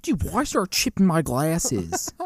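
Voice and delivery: put-on slow, high, loud surfer-Baltimore accent; goofy Baltimore accent, vowels stretched out; mock Baltimore accent. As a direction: ham up a drawling Baltimore accent. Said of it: "like, a Baltimore accent".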